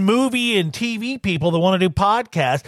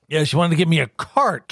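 usual speaking voice

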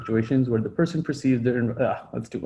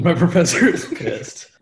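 laughing mock; 4 semitones higher, slower than usual. Mock college scene.

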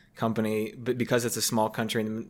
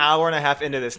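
ordinary talk, nothing marked

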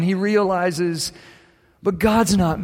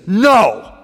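firm no; 4 semitones higher, 6 dB louder than usual. Retold or imitated. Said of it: "He just says".

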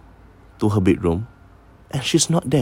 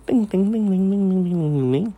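high, soft sung-out ting ting like gamelan bells; chiming gamelan imitation; 9 semitones higher, 4 dB quieter than usual. To friends, imitating the distant gamelan music, high and soft. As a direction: mimic the gamelan chimes softly.

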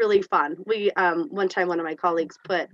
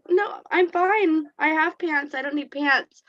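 mock patient reply; 9 semitones higher than usual. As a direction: calmly turn him down. Her reply again.

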